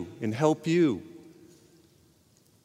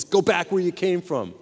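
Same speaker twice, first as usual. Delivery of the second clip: mildly angry demoniac; 3 semitones higher than usual. Retold or imitated. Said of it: "he's real mad and upset".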